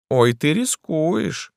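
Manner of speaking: high, slow, drawn-out warning; sing-song mock menace; affected emperor, teasing threat. A narrator's reading. Emperor figure threatening lightly.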